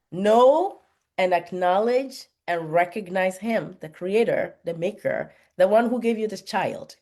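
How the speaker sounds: scripture reading. Acted. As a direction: read it like a sermon text, weighing each phrase. Reading Proverbs aloud to parents.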